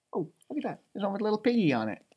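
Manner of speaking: usual speaking voice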